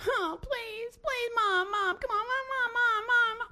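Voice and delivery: high, wailing mock child whine; whining. Child pestering mom over and over, hammed-up whining. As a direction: nag loudly up high, stretching every word like a pestering kid. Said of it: "The whining".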